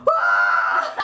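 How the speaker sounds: loud comic scream of horror; mock scream. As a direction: let out a retold shriek. Acting scream at the intruder.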